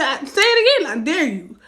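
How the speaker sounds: very high, loud dare; retold dare. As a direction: snap the dare back. Daring him.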